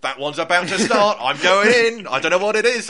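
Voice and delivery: loud and high, eager to go in; mock-thrilled inner voice, going for it; excited decision. Excited thought on entering screen.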